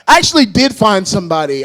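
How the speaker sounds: ordinary delivery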